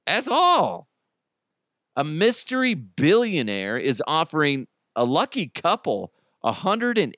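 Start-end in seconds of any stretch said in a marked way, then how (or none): 0.1-0.9 offended Swiss voice. Retold or imitated. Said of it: "All you Swiss people are like".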